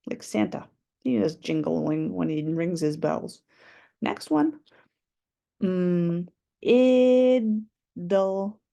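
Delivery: ordinary talk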